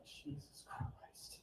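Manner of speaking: breathless, unvoiced whisper; dad's stunned whisper.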